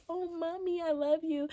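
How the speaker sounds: put-on high, soft child voice; high, soft little child saying it sweetly; kid voice. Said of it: "they say".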